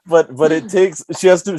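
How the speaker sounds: ordinary talk, nothing marked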